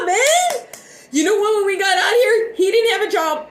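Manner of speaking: choked-up, near crying; tearful. Real, not acted. Telling her viewers how they arrived broke, close to tears. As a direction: voice climbing high and breaking. Emotional recounting of hardship.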